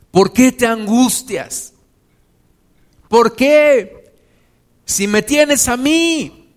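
high, loud, slow preacher's cry; full-force preaching. Acted. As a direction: preach it out to the whole congregation.

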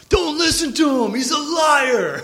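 high, drawn-out mock heckling; heckler calling out. Dude at the bar butting in to heckle, high drawn-out mock yell. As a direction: call it out high, stretching the last word.

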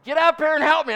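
high, loud imitation of a screamed demand; yelling for help. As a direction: holler like an exasperated horse owner. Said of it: "Vicky's a screaming and a yelling".

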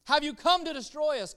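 preacher challenging the church, high and loud; rhetorical challenge. Real, not acted. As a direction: throw the question at the congregation. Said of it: "Can I, can I tell you?".

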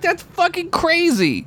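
shrill stunned disbelief; shocked. Real, not acted. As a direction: squeal out the shock. Shocked outburst at the twist.

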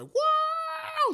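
high, loud burst of wow; excited wow. Retold or imitated. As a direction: yelp it out like you just woke up brand new. Waking up refreshed after sweating.

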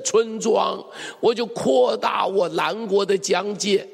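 high, loud preaching about the neighbour who raids; rising to a shouted climax of condemnation; fervent preaching. Acted. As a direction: push it out like a fiery preacher. Preaching at full force.

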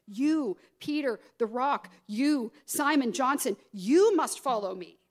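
each "you" hit hard and held; deliberate, pointed "you", set off by itself; stress on YOU. Acted. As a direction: punch "you" every time, strongest on the last. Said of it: "it is emphasized in the Greek".

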